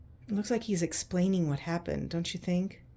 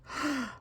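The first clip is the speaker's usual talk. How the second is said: sharp little gasp, higher; surprised gasp; 7 semitones higher than usual. Acted. Reader surprised at picture.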